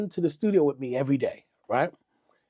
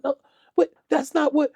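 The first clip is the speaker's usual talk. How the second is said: high-pitched imitation of objecting; mock protest; 11 semitones higher than usual. Accused guy tries to interrupt [name]'s account, high mock protest.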